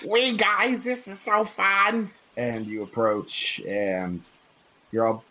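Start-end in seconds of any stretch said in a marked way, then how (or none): 0.1-2.1 put-on high excited kid voice. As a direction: squeal it like a little boy. GM voices kid Rufus on dog.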